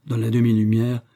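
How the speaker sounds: ordinary delivery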